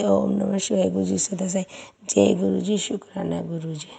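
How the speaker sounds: ordinary talk, nothing marked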